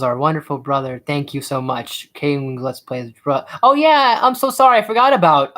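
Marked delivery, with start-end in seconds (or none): 3.6-5.6 high, loud burst of remembering; sudden apologetic realization. Real, not acted. Streamer realizes he forgot a friend's channel, blurting apologetically. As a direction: blurt the apology as it hits you.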